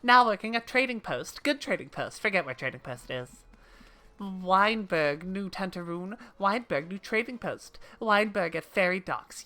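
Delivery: excitable trader in broken speech; bouncy, short declarations from a quirky shopkeeper; eager game shopkeeper. Acted. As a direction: play a simple, emphatic merchant character. Voices Kyokurun, game shopkeeper.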